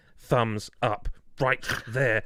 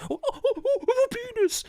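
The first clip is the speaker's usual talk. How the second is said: mock shocked gasp. Mock startled neighbour seeing him.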